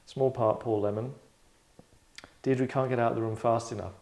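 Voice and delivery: usual speaking voice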